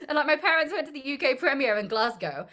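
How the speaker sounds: ordinary talk, nothing marked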